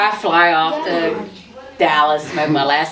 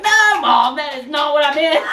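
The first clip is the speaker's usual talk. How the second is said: higher, laughing, exasperated mom; laughing mock protest as mom; 8 semitones higher than usual. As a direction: play the outraged mother, laughing through it. Her mother objects to the ticket.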